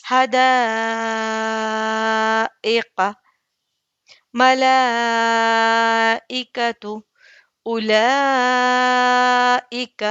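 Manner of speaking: very slow, aa held long and level in حدائق, ملائکۃ, اولائک; elongated madd. Shown as a demonstration. Teacher shows how to stretch it, elongated madd. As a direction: hold the aa steady, then release into the short ending of ملائکۃ. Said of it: "اسے کھینچیں گے".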